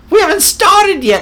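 high, loud mock scolding; indignant official's protest. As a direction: shout it like an exasperated official. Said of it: "And he says".

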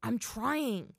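mildly exasperated objection; protesting. A narrator's reading. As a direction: protest while under strain. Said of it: "he protested".

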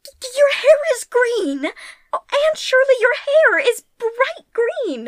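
gasping in disbelief; shocked exclamation. Acted. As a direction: cry out in horror at it. Diana shocked by green hair.

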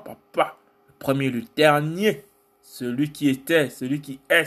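rhythmic litany of divine titles; spoken worship in short, driving phrases; spoken praise. Real, not acted. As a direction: declaim each title as praise to God. Declaims titles of God.

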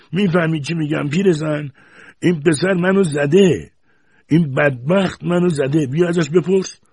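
high, loud outraged cry; shouting. A narrator's reading. Grandfather yelling to grandmother that the boy hit him. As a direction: shout it across the room at grandmother. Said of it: "فریاد زد".

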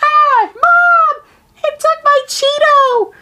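shrill, very high squawking cries like a gull; mock gull squawk yelling for Mom; seagull screech. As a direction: screech it out like a seagull stealing food. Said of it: "You're gonna hear".

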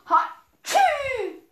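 high loud fake sneeze. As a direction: sneeze big.

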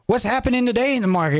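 a sudden what-is-going-on; imitating a trader caught off guard; startled surprise. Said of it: "you're like".

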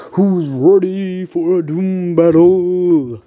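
slow, loud, high hype call; announcer hype. Acted. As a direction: drag it out like a fight announcer. Hyping the fight start.

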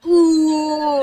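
loud high mock groan; mock pained groan. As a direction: groan like it hurts. Mock pain at being gossiped.

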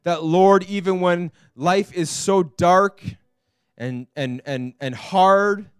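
fervent spoken prayer to God; earnest prayer. Acted. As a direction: pray aloud for everyone, heartfelt and steady. Pastor prays over the congregation.

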